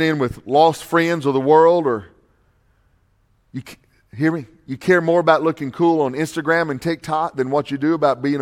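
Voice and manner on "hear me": ordinary delivery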